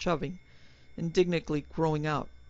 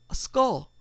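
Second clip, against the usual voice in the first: horrified; 7 semitones higher, 5 dB louder than usual. Acted. Horrified realization mid-speech.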